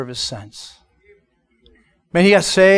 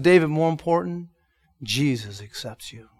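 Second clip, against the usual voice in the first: soft, low, sincere comfort; quiet reassurance; 3 semitones lower, 7 dB quieter than usual. Retold or imitated. Pastor assuring David that Jesus accepts him, quietly. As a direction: drop it gently to him.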